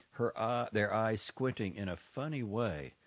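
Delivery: usual speaking voice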